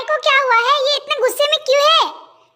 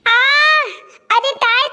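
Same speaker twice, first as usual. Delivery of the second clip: ordinary delivery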